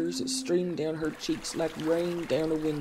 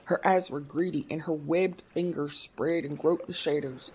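plain reading voice

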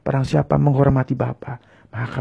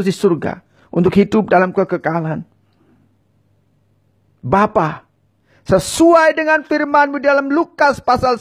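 higher, louder, impassioned pleas for forgiveness; fervent pleading prayer; 11 semitones higher, 6 dB louder than usual. Acted. Preacher voicing sinners' confession for viewers to repeat, fervent and pleading. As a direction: cry out each sin to God with rising urgency.

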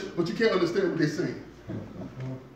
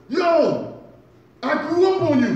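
excited millennial fan; 13 semitones higher, 7 dB louder than usual. Retold or imitated.

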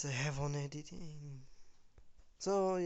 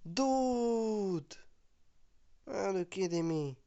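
surprised; 5 semitones higher, much slower than usual. Real, not acted. Reacting to something in game.

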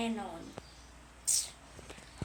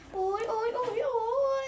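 high whiny ow ow ow; hammed-up pained whimpers; mock pained wail; 9 semitones higher, 4 dB louder than usual. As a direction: wail like you can't hold it any longer. Pretend pain, bursting to go.

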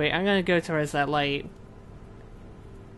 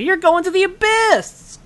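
high, loud, sing-song sarcasm; mock cheerful; 14 semitones higher, 5 dB louder than usual. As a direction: cheerily send them to doom. Mocking the grim farewell.

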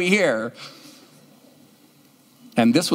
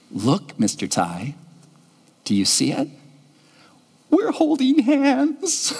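put-on little girl voice; 5 semitones higher than usual. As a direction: reveal the secret like a small child. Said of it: "and she said".

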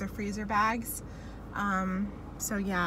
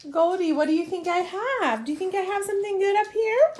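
high, fast, teasing pet voice; high teasing dog talk; 9 semitones higher, 4 dB louder than usual. Real, not acted.

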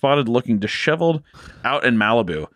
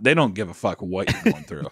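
normal talking voice

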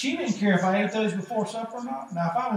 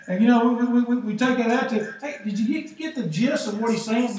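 ordinary talk

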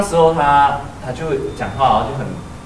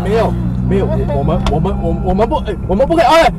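higher, louder stammering; frantic pleading; 9 semitones higher, 6 dB louder than usual. Retold or imitated. Friends restraining her from the ditch, frantic. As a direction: grab her and beg her to stop. Struggling to hold friend back.

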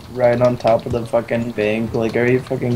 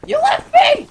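high mock wail; slower than usual. Playful wail at departing friend.

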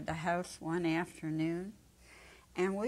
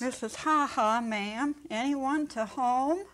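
calling out; 6 semitones higher, 4 dB louder than usual. Acted. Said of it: "My friend calls".

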